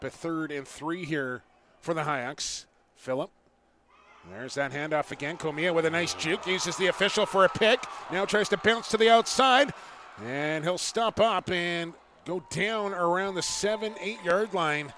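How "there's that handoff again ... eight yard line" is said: excited play-by-play. Real, not acted. Big run play call.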